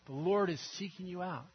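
usual speaking voice

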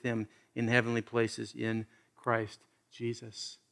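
low, measured Bible reading; hushed, deliberate pulpit reading; scripture reading. Acted. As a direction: read the verse solemnly, slowing and softening to the close. Reads the Bible passage aloud.

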